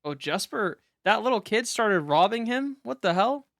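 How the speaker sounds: loud, high-pitched disbelief; shocked outburst. Real, not acted. Streamer reacting to chat as the little kid starts robbing him, shocked. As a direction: burst out at the unexpected twist, voice jumping up.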